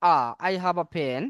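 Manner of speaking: slow, with the "a" in "I have a pen" said as a short open "আ"; article as short a. Shown as a demonstration. Teacher models the article sound for students, slowly and clearly. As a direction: say "a" like Bengali "আ", not "এ", slowly. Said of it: "এই উচ্চারণটি করতে হবে আ".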